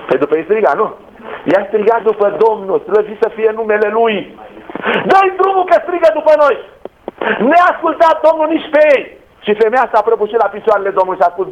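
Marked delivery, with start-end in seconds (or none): 5.1-6.6 disciples fed up, pushing Jesus; annoyed demand. Acted. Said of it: "ucenicii au spus".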